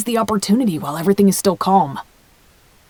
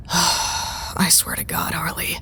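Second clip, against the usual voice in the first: whispered exasperation; 3 semitones lower, 6 dB quieter, slower than usual. Acted. Exasperated, drunk complaint.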